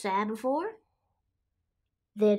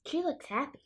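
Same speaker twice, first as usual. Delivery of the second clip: ordinary delivery, nothing marked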